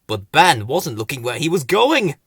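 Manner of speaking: ordinary delivery, nothing marked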